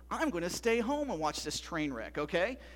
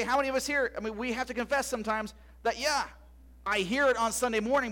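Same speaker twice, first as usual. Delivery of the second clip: usual speaking voice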